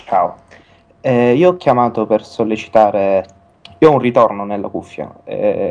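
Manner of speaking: usual speaking voice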